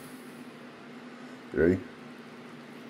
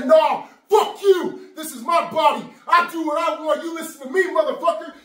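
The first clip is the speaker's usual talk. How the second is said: defiant shout; 3 dB louder than usual. Shown as a demonstration. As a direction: bellow it at your own body. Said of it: "and you say".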